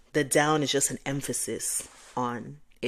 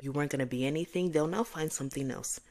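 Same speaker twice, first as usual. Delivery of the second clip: ordinary talk, nothing marked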